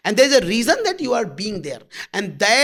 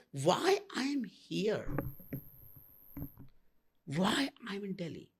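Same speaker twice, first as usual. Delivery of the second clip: inner question; 14 dB quieter than usual. Retold or imitated. Voicing the seeker's inner question.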